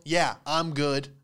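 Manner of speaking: usual speaking voice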